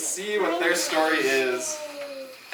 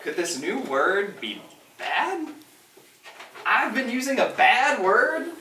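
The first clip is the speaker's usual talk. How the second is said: slow question climbing to higher disbelief; dawning worry, pitch rising as it sinks in; dismayed little bird; 5 semitones higher than usual. A narrator's reading. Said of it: "he said".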